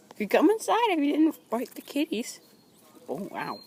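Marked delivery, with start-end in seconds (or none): 0.1-2.4 high sing-song talk to cat; pet talk voice. Real, not acted. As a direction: coo it like talking to a pet.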